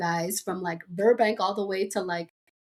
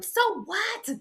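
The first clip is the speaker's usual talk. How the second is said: put-on defiant teen; 13 semitones higher than usual.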